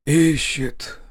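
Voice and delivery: exhaled, lightly moaned word; a touch of hoarse moaning. A narrator's reading. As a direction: breathe it out as a groan. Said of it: "стонуще выдохнул он".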